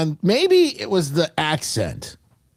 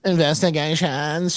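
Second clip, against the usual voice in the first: mocking vocal fry. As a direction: parrot the word "Investigations." with Kardashian-style vocal fry. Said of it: "She almost has that vocal fry".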